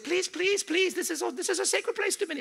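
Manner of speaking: put-on high, pleading Middle Eastern accent. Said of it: "I gotta do the accent".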